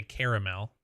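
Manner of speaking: "caramel" in three syllables, CARE-uh-mel; exotic-sounding caramel. Shown as a demonstration. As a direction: give "caramel" its full middle syllable. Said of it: "trying to be exotic".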